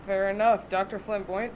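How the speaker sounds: ordinary talk, nothing marked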